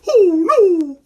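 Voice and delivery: very high, loud mock-frightened wail; scared little brother. Showing kids how little brother gets scared, high frightened wail. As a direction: wail it like a terrified little kid. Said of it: "little brother gets scared like this".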